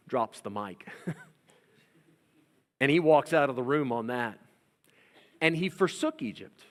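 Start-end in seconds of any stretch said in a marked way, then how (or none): none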